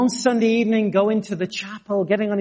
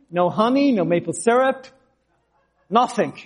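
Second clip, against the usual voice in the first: normal talking voice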